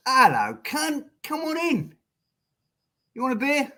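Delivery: a rough attempt at an Australian mate's greeting, pitched high; half-hearted Aussie accent at the door. A good Aussie mate welcoming a friend in, in a mock Australian accent. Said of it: "I can't do an Australian accent".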